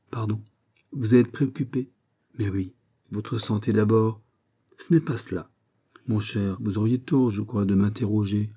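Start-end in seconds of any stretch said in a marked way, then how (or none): none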